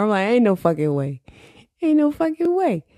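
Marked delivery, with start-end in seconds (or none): 1.8-2.8 much higher-pitched incredulous repeat; mock disbelief. Podcaster reacting to listeners in Singapore, high mock disbelief. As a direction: jump way up in pitch, playfully refusing to believe it.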